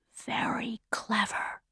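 very soft, slow whisper; secretive whisper. A narrator's reading. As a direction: breathe it slowly, barely audible. Gray-haired man whispers back secretly.

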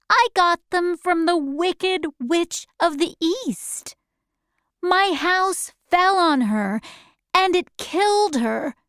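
put-on a small girl answering politely; young girl Dorothy. A narrator's reading.

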